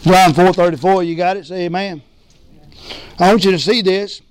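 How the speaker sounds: ordinary talk, nothing marked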